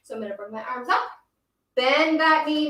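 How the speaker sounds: normal talking voice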